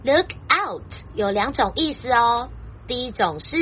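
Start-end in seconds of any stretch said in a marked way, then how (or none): none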